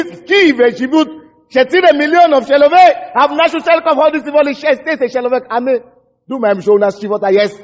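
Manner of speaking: ordinary delivery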